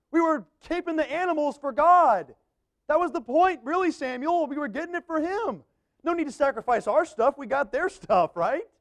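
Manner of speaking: high, wheedling Saul; Saul justifying. Acted. Saul justifying to Samuel why they took the stuff, high and coaxing. Preacher voices Saul's justification.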